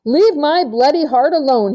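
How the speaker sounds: put-on a four-year-old standing his ground; defiant. A narrator's reading. Marshall defies the giggling girls, defiant child voice. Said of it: "chin was sticking out defiantly".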